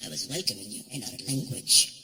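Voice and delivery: low, formal and measured; dignified prince. Retold or imitated. Prince gently explaining the Arabic name, low and formal. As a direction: speak down low like royalty.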